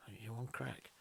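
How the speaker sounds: hushed disbelief, unvoiced; incredulous whisper. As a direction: breathe it in disbelief. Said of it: "he's looking at me like".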